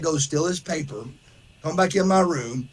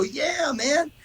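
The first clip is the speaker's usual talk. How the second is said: eager yeah man; 10 semitones higher than usual. Retold or imitated. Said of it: "I go".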